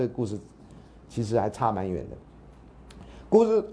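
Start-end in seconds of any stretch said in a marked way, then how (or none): 3.3-3.7 coughing, choked on saliva; choking on saliva. Real, not acted. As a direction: sputter and cough mid-word. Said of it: "被自己口水呛到".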